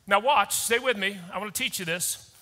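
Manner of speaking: ordinary delivery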